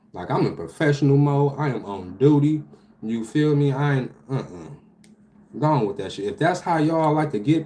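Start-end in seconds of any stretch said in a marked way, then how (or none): none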